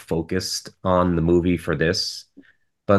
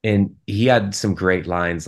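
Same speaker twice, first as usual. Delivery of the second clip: normal talking voice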